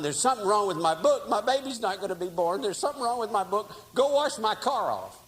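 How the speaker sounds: upset father ordering his son around; mild panic. Retold or imitated.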